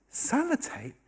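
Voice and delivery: high-pitched, puzzled echo; incredulous query. Retold or imitated. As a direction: repeat it high, in mock disbelief. Santa checks the whispered wish.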